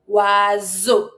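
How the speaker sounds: "Oiseau" as "wa-zo", the S voiced as Z; low, careful "Oiseau" with a buzzing Z; Z sound. Shown as a demonstration. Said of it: "Z sound".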